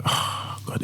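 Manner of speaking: unvoiced whisper to himself; mock dread under the breath; whispered inner thought. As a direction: breathe it like a private panic. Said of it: "in my head".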